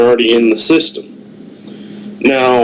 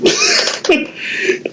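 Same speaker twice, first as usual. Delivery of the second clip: breathy chuckle; 5 dB quieter than usual. Real, not acted. Laughs after secretive remark.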